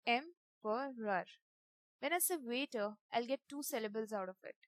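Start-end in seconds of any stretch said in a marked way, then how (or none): 0.1-1.2 "Emperor" drawn out slowly, each syllable apart: em, per, or; slow syllable-split emperor. Shown as a demonstration. Teacher counting the three syllables in "Emperor" for the class, slowly. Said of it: "I'll get three syllables for this word".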